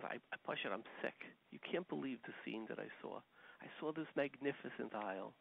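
soft, hushed telling of grief; soft upset confiding. Retold or imitated. Man telling his wife of the sight, softly.

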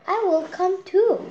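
high, loud eager offer; volunteer with a cluck of zeal; eager hen. Acted. Henny Penny offering to come along, eager and high. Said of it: "said Henny Penny".

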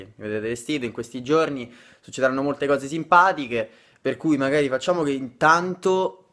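normal talking voice

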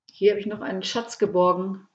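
ordinary delivery, nothing marked

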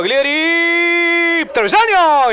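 excited call. Real, not acted. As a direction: shout as it hits the bar. Shot hits the crossbar.

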